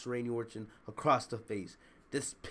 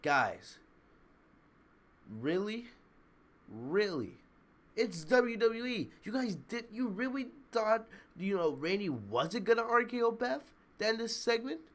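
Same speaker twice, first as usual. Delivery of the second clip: higher, disbelieving "really?" at the crowd; incredulous scolding; 7 semitones higher than usual. Retold or imitated. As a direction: roll your eyes at the gullible fans. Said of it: "I'm like".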